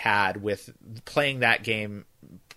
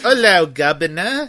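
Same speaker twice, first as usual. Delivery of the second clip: hammed-up British accent, higher and louder; mock British accent; 5 semitones higher, 7 dB louder than usual. Shown as a demonstration. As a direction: put on an exaggerated cheeky British accent. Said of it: "There, that was an accent".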